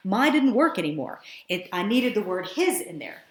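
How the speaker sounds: ordinary talk, nothing marked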